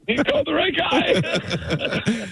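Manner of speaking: high, slow words broken up by laughter; laughing. Real, not acted. Caller joining the joke about helping with delts, laughing. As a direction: stretch it out while really cracking up.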